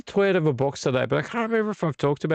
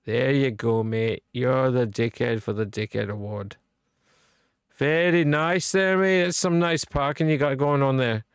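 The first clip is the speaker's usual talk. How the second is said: put-on rough go at a Scottish accent; mock Scottish accent. Handing the bad parker his award, mock Scottish accent. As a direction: put on a loose Scottish lilt. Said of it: "That's a nice Scottish accent".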